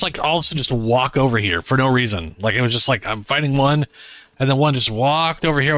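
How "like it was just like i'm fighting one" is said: normal talking voice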